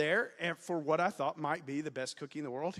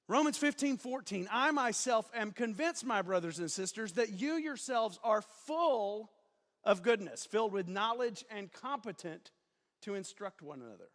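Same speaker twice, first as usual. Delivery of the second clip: preacher voice; 4 semitones higher than usual. Real, not acted. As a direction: proclaim it like a pulpit preacher. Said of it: "I don't know why I'm yelling".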